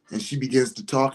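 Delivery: normal talking voice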